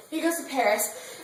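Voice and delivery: usual speaking voice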